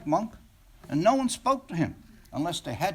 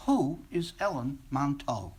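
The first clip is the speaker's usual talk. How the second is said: usual speaking voice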